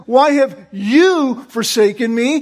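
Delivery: "you" hit hard with a rising end; wrong stress on YOU. Shown as a demonstration. Preacher showing another wrong reading, stress on "you". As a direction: punch "you", leave "me" light. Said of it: "It isn't".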